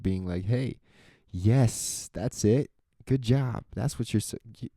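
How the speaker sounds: normal talking voice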